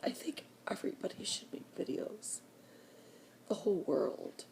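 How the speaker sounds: ordinary delivery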